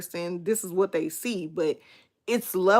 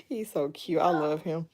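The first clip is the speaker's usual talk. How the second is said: ordinary talk, nothing marked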